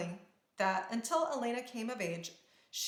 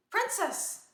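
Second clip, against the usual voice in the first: calling out; 10 semitones higher, 4 dB louder than usual. A narrator's reading. Guard calling after princess.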